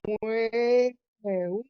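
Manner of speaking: high, drawn-out mocking wail; mock crying. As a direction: imitate a crybaby's "wah". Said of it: "he'd start going".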